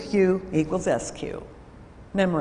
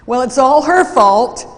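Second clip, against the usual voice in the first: loud, raised, accusing voice; angry blaming; 4 semitones higher, 9 dB louder than usual. Retold or imitated. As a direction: throw the blame at her hard. Employee loudly blames his colleague.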